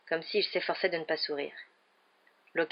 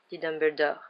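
usual speaking voice

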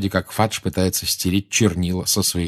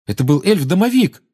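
high, loud, urgent protest; youthful, raised-voice denial; boy cutting in; 8 semitones higher, 6 dB louder than usual. A narrator's reading. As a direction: jump in to clear yourself. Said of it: "вмешался Гарри".

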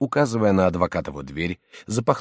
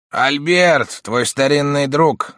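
put-on high, loud hearty old friend; uncle voice; 7 semitones higher, 7 dB louder, slower than usual. A narrator's reading. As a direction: greet him as an old friend. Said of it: "ответил дядя".

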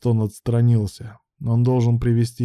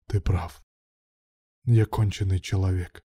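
low, drained admission; lifeless voice; 3 semitones lower, 4 dB quieter than usual. A narrator's reading. Man admitting guilt to partner, lifeless. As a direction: say it lifelessly, pitch sinking. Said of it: "безжизненным голосом произнёс Руслан".

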